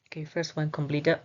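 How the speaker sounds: usual speaking voice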